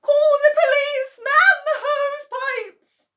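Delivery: high, loud, slow, half-sung cry for help; frantic cry. Acted. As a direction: shout for the fire brigade in a high, tearful voice. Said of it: "cried Missus Cranky".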